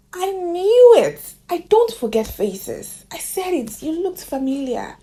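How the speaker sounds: thrilled to finally place her face; excited recognition. Retold or imitated.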